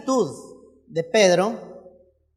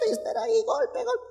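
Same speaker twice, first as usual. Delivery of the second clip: high, tiny soft voice; squeaky little mock-meek voice; meek pushover; 11 semitones higher, 9 dB quieter than usual. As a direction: mimic a timid one quietly taking it. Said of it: "es siempre calladito".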